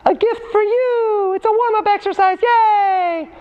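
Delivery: very high, drawn-out playful squeal; tiny high-pitched gift-giver voice; silly squeaky voice. Pretend. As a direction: present it in a silly, sky-high falsetto. Playful presenting voice with exercise.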